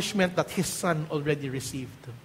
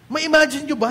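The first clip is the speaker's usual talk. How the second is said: ordinary delivery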